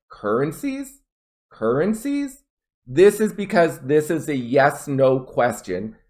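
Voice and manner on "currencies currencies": "Currencies" climbing high at the end; upward intonation. Shown as a demonstration. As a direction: demonstrate a questioning rise on "Currencies". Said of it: "Notice I went".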